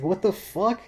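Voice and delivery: high, rising disbelief; incredulous. Acted. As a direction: swoop up high in mock disbelief. Acting out disbelief at the story.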